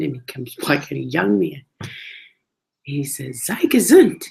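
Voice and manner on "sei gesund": higher, louder mock Yiddish call; imitating a hearty Yiddish well-wisher; imitated Yiddish greeting. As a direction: call it out in a lilting, raised voice.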